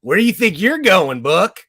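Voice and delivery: a bully confronting the hero; mock angry villain. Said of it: "angry voice".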